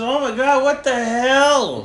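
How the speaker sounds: high, loud retold yell, last words stretched; retold outcry. Retelling his foot getting stomped, high indignant yell. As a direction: re-enact the shocked protest. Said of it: "I said".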